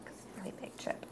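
breathy whisper. Real, not acted. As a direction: whisper it close to the mic. Whispered aside.

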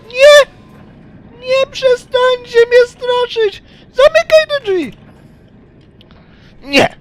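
very high, loud, slowed scream; panicked screaming. Real, not acted. Gamer screaming at the pigs to stop scaring him. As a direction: shriek in fear.

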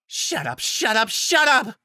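rising from snarl to high scream; building to a scream. Acted. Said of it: "Nightshade screamed".

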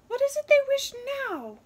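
high, loud, fast stern bird; asking sternly. Acted. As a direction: scold with impatience. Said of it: "the wren asked sternly".

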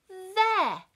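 stern mum voice. Retold or imitated. Mum pointing.

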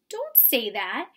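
distressed mouse. A narrator's reading. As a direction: beg your friend to stop. Tucker pleading, mouse voice.

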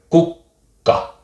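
Kukka split kuk-ka with a short pause; Finnish double k. Shown as a demonstration. Finnish tutor demonstrating the double k in Kukka. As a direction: hold the k before releasing Kukka. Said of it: "those two letters together".

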